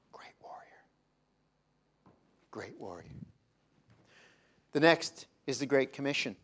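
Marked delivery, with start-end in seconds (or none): 0.1-3.1 whispered aside. Shown as a demonstration. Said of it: "dismissing the lesser title".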